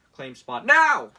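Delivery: loud, pushy sales-pitch voice; mock campaign ad announcer; hammed-up ad read. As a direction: sell it like a cheesy infomercial. Reading Trump campaign text aloud.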